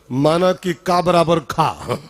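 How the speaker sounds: slow, spelled-out like an algebra rule; slow formula recital. Shown as a demonstration. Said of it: "algebra formula repeated". (बीजगणित का सूत्र धीरे बोलना)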